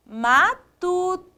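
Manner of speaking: syllable by syllable. Shown as a demonstration. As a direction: draw out each syllable of "matuto". Teacher gives rhyme word by syllables. ("matuto" devagar, sílaba por sílaba)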